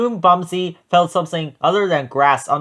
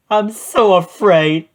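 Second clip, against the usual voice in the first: high, loud, stretched-out fear; frightened wail; 6 semitones higher, 5 dB louder, slower than usual. Acted. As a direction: wail it slowly as a scared goat. Frightened goat flees the chupacabra.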